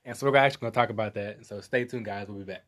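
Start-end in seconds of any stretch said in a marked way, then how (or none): none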